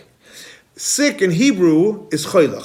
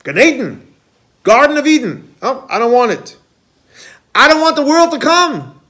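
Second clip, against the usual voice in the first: higher, emphatic cry of refusal; Alter Rebbe's refusal; 4 semitones higher than usual. Retold or imitated. Chassidic master turning down paradise before God, impassioned and emphatic. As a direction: call out each refusal, voice climbing. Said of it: "He would say like this".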